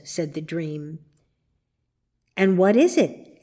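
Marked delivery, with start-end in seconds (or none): none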